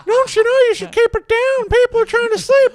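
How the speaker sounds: very high squeaky puppet scolding; high cartoon puppet nagging the kids; gun puppet voice.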